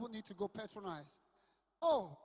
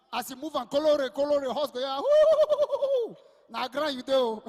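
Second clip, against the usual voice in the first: louder mock commentary building to a whoop; excited yelling; 12 dB louder, slower than usual. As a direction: cheer the horse on, then a yelp as it falls. Mock polo commentary, horse falls.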